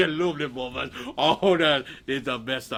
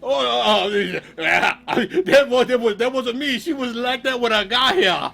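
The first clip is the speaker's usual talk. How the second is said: loud, stammering protest; flustered denial; 6 semitones higher, 8 dB louder than usual. Acted. Wolf caught with sack, blustering denial. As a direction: trip over excuses, louder and higher.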